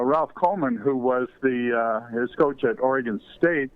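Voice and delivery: ordinary talk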